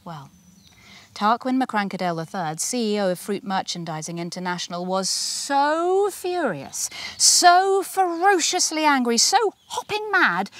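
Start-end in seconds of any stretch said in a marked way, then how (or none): 5.1-10.5 high, slow rising anger; building fury. A narrator's reading. Narrator builds Tarquin's rage, slow and high.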